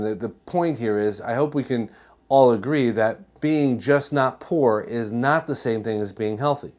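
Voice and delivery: usual speaking voice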